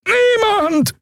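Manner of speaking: very high-pitched alien scream; screaming Draxyl. Acted. Prisoner answering who his contacts are, screaming. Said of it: "schrie Schuankor".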